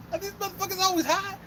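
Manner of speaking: very high, squeaky incredulous question; mock bewilderment. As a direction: retell your own bafflement in a high voice. Acts out his baffled reaction.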